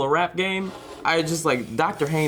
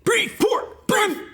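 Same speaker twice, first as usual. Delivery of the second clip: shouted; much slower than usual. Pretend. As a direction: yell each word out. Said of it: "let's make sounds like".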